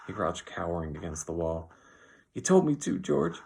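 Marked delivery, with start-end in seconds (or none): 2.3-3.4 high, loud distressed wail; miserable. A narrator's reading. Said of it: "he said miserably".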